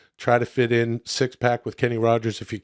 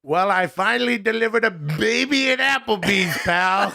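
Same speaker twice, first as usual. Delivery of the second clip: high, slowly drawn-out comic announcement; Adam Sandler; 9 semitones higher, slower than usual. Acted. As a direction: proudly ham up the review, stretching the words. Voices Adam Sandler's reviews.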